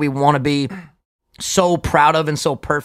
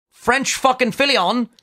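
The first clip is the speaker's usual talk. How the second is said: slow, higher attempt at a French accent; bad French accent; 6 semitones higher, slower than usual. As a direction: stretch it out in mock French. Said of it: "I can't do a fucking French accent".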